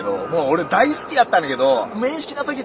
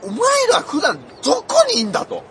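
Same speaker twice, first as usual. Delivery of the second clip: put-on exasperated challenge; mock indignant demand; 13 semitones higher, 3 dB louder than usual. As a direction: demand it in exaggerated, high-pitched outrage. Mock tirade at unknown comedians.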